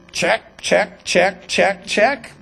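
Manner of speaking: short separate ticks, evenly spaced; mock-smug box-ticking; smug checklist. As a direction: tick off boxes in self-approval. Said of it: "would smugly look at the law".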